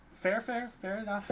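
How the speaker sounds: high, hurried-to-concede startled voice; lightly acted startled backing down; startled halfling. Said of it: "see him kinda jump back".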